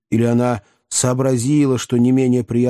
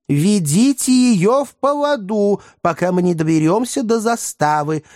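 calling out advice; 9 semitones higher than usual. A narrator's reading. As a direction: call instructions across a distance, voice pitched up. Shouting advice to struggling rider. (высокий окрик с советом)

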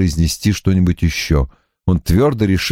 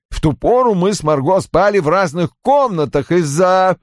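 put-on high-pitched, emphatic older father; Roy's agitated character voice; 11 semitones higher than usual. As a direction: play an indignant father, pitched well up.

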